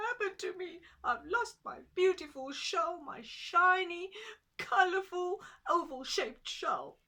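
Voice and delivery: high, loud wailing tortoise voice; small tortoise lamenting, high and loud; Tutu the tortoise. Acted. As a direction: pitch it up and wail over the lost shell. Tortoise lamenting lost shell.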